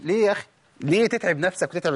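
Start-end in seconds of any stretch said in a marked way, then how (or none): none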